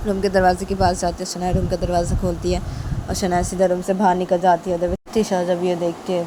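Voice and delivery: plain reading voice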